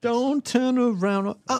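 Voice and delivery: slow high spooky warning; slow eerie warning voice; ominous warning. Pretend.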